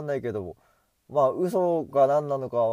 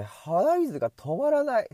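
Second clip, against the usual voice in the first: higher, slow whine about the runny nose; high, slow complaint; 9 semitones higher than usual. Shown as a demonstration. Vlogger whining about his runny nose, higher and slower. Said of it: "repeated phrase".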